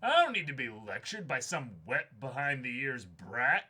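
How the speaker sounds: put-on loud indignant old-timer, rising fury; angry old diver. Grizzled diver yelling at the young guide, angry.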